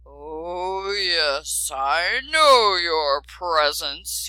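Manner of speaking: slow, rumbling dragon growl; a huge grumpy beast growling. A narrator's reading. Dragon grumbles at the prince and princess, slow rumbling growl. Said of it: "growled the dragon in a huge rumbling voice".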